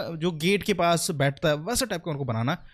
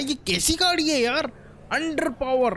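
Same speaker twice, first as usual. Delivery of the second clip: high-pitched exasperation at a useless truck; exasperated complaint; 10 semitones higher, slower than usual. Real, not acted. Complains as truck gets stuck.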